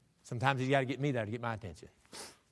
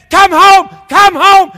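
high, very loud call home; 23 dB louder, much slower than usual. Acted. Parent to a child gone wrong, calling out. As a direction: holler it far down the road.

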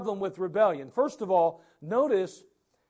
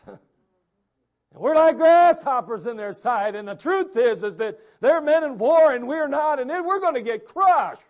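fearful spies; 6 semitones higher, 9 dB louder than usual. Retold or imitated. Spies warning the people about the giants, alarmed. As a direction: warn them in panic. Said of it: "there's giants in the land".

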